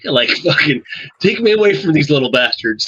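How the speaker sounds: high, pleading, half-laughing plea; hammed-up plea for rescue from recruits; mock desperate drill sergeant. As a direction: play a drill sergeant at the end of his rope, begging out. Drill sergeant begging for relief.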